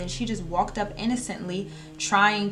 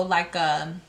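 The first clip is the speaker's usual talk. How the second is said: usual speaking voice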